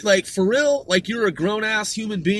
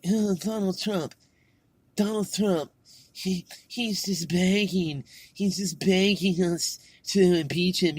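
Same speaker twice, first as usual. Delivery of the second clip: lightly mocking, slowed babble of a politician; mock her rambling, a touch dopey; Nancy Pelosi babbling.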